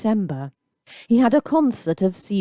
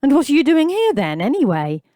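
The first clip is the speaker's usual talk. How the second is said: blunt challenge; 8 semitones higher, 4 dB louder, faster than usual. A narrator's reading. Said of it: "dropping the mocking voice".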